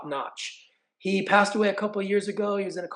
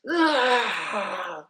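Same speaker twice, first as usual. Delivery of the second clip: short hype yell, higher and louder; announcer yell; 4 semitones higher, 4 dB louder than usual. Retold or imitated. Gym staff describing the announcer job with a short hype cry. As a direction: let out a brief ring-announcer cry. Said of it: "somebody to be like".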